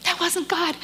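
high, tearful insistence; choked up. Real, not acted. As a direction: voice it through the tears, pitched high. Moved after upsetting story, choked up.

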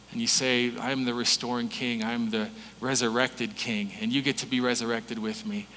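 soft, low prayerful voice. Acted. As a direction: pray quietly and intimately. Pastor prays over congregation.

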